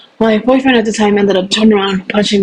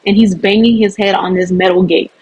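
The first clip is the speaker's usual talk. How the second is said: normal talking voice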